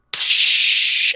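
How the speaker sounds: long hushed "psh" sound effect; voiceless burst for a door opening; imitated whoosh. Imitating door opening onto light.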